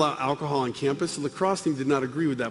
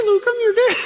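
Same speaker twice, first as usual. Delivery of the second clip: put-on very high, loud little-girl Vader voice; squeaky Darth Vader; 6 dB louder than usual. Darth Vader telling Luke, absurdly high squeaky voice. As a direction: Vader with a tiny girl's voice. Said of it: "imagine ... Darth Vader comes on".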